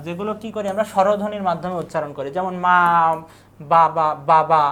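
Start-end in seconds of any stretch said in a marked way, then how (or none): 3.6-4.6 বাবা said slowly and louder, each বা held clearly; syllable demo. Shown as a demonstration. Teacher sounding out বাবা slowly and loudly for students. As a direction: drag out বাবা so each বা is heard. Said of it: "এখানে বা না লিখে বাবা".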